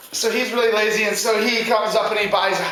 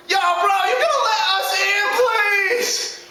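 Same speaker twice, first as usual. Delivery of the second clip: high, whiny bro begging; desperate little-pig plea; pleading second pig; 10 semitones higher than usual. Acted. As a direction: pitch it way up and beg. Pigs beg third brother.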